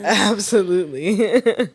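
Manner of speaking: slow, loud, laughing. Real, not acted. Laughing after joke.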